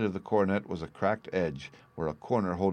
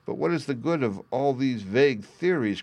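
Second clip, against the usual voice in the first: raised, exasperated banker's cry; impatient; 6 semitones higher, 4 dB louder than usual. A narrator's reading. Banker dismissing the detective's theories, impatient. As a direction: cut in impatiently, pitched up. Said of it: "cried the banker impatiently".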